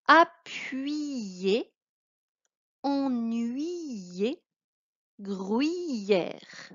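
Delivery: careful model reading of a word list; reading aloud. Shown as a demonstration. Teacher reading word list aloud to pupil. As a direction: pronounce each word distinctly. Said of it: "Tu mets sur pause".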